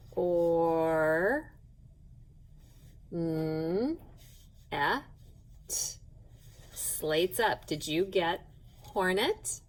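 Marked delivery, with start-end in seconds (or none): 0.1-5.0 "Or. N. Et." as isolated sounds, each held, with long gaps; sounding out. Shown as a demonstration. Teacher going through the sounds for pupils, sounded out low and slowly. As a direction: stretch "Or", hum the "N", then separate "Et" into vowel and t. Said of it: "let's look at our sounds".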